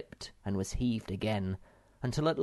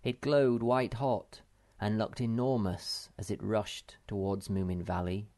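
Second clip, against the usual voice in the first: usual speaking voice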